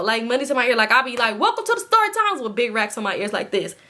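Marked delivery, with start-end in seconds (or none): 1.4-2.4 high, squealed host welcome; mock show greeting. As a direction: parody your own intro in a high voice. Imitates own show greeting, mockingly.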